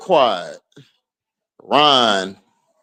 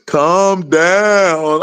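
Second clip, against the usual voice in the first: mocking Nola. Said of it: "Nola was like".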